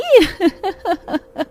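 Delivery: high mocking giggle; bright teasing laughter. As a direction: laugh at her friend slipping in mud. Laughing at muddy friend.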